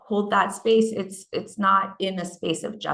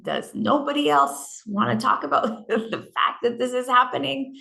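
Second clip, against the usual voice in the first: retold disbelief; 5 semitones higher than usual. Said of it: "where I'm like".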